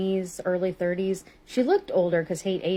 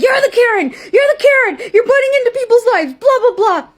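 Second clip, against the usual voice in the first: screeching mock Karen, much higher and louder; hammed-up shrill tantrum of an angry stranger; mocking the woman screaming back in the parking lot; mock shrieking Karen; 12 dB louder than usual.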